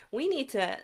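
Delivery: ordinary talk, nothing marked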